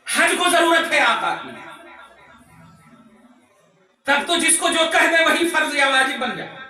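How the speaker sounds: high, impassioned sermon delivery; preaching at full force. Real, not acted. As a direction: drive it home to the congregation. (जोश भरी ऊँची तक़रीर)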